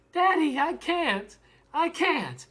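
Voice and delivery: put-on high, small pleading child voice; little girl near tears. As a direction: plead like a child about to cry. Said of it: "looks like she's about to cry".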